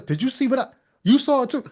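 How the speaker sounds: mock disbelief, voice jumping up; excited mock witness. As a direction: act amazed that they saw it too.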